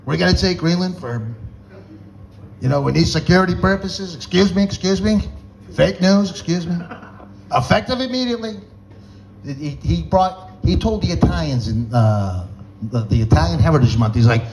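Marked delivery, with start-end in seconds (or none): 0.1-8.6 higher-pitched, choppy presidential boasting; Trump. Acted. President announcing a Greenland takeover, as Trump. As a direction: fire off short, insistent declarations in a pitched-up voice. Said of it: "Trump's out of his mind".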